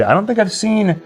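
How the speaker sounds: ordinary talk, nothing marked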